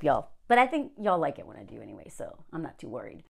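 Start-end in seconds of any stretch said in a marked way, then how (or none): none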